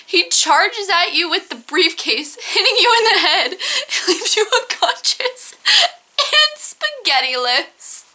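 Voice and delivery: high read breaking into laughter; laughing while reading. Real, not acted. Reader finishing the spaghetti briefcase story, laughing. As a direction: push on through a fit of laughing. Cracks up at absurd ending.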